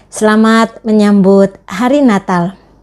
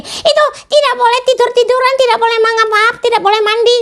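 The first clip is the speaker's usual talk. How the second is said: put-on much higher childlike puppet voice; puppet friend; 13 semitones higher, faster than usual. Teacher voicing her puppet for the children, high puppet voice. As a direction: play the cheeky puppet sidekick, pitched way up.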